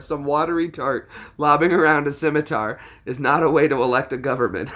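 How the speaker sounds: laughing quote. Retold or imitated. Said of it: "I love that part".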